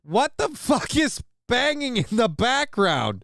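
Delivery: high, drawn-out exasperated outcry; baffled outburst. Real, not acted. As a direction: cry out in disbelief at the racket. Exasperated reaction to noise in clip.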